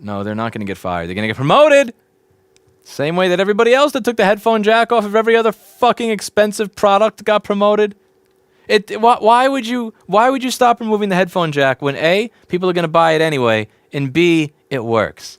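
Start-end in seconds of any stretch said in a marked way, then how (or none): 1.1-7.9 loud, high outburst of outrage; indignant rant. Real, not acted. Streamer rants about headphone jack removed from every product, outraged. 8.7-15.4 loud, high-pitched exasperation; indignant questioning. Real, not acted. Streamer asks why anyone would stop removing headphone jacks, exasperated. Outraged rhetorical question about Dell.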